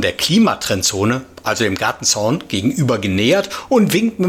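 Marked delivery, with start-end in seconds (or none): none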